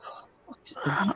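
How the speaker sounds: soft, slow, voiceless mutter of disgust; muttered mock exasperation. Decorator muttering at the tiny spider, under his breath. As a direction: grumble it under your breath, slowly. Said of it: "It's like".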